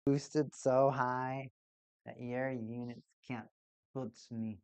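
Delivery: slow menacing villain, fading low; villain monologue. Acted. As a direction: gloat slowly like a villain, sinking low. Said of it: "Don't I sound like a good villain?".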